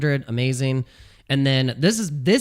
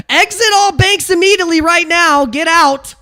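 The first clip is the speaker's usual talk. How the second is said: much higher, louder frantic TV stock pundit; Jim Cramer impression; 9 dB louder than usual. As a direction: shout it like a panicked market pundit on air. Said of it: "the fact that Jim Cramer said that".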